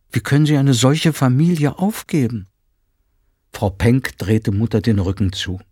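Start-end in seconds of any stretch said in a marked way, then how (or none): 0.1-2.5 raised, louder pleading disbelief; a mother voicing dismay; indignant question. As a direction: ask it in shocked reproach. Mutter reproaches Frau Penck.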